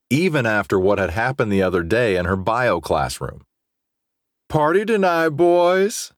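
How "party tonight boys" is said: high, loud party call; shouting. A narrator's reading. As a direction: yell it across the locker room. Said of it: "Carter shouted".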